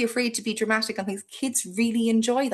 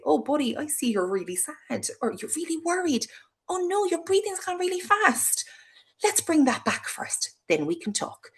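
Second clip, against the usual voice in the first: much higher, gentle and caring; calm a frightened child; soothing; 9 semitones higher, 4 dB quieter than usual. Shown as a demonstration.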